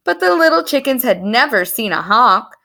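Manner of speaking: ordinary delivery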